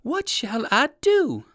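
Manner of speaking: high, slow worried question; fretful plea. Acted. Trapped rabbit asking himself, high slow fretting. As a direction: ask helplessly, fretting. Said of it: "exclaimed Uncle Wiggily".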